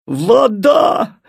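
rising alarmed cry of Вода; alarmed shout. Acted. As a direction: call out Вода in alarm, pitch climbing. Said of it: "закричал ей огонь". (крик огня: Вода с подъёмом)

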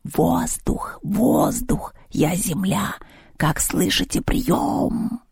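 hissed fake radio call; nasty hissing voice. A narrator's reading. The cat plays ground control calling air, nasty hiss. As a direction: imitate a crackly radio operator in a hiss. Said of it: "противным голосом зашипел".

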